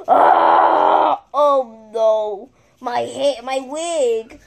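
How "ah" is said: shocked gasp and shriek. Pretend. Gasps at stolen wig.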